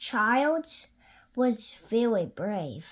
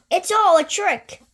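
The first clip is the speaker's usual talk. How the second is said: high, loud bold little voice; brave child; 8 semitones higher, 10 dB louder than usual. Acted. Gruffalo's child boasting, high and loud. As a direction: insist you're not scared. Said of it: "said the Gruffalo's child".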